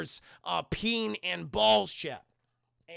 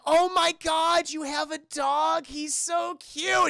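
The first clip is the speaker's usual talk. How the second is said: high, slow squealing coo, much louder; gushing over dog; 9 semitones higher, 5 dB louder, slower than usual. Retold or imitated. Karen cooing over the dog, squealy excitement. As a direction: swoon over a cute puppy.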